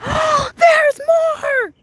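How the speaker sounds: high, loud shout after a gasp; gasp, then cry out in high amazement; excited gasp. Real, not acted.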